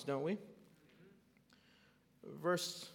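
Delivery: ordinary delivery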